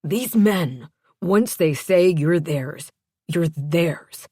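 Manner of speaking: slow, emphatic warning; forceful, deliberate fatherly warning; stern father. A narrator's reading. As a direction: drive the warning home word by word. Father warns daughter about dangerous men.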